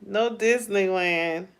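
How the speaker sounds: normal talking voice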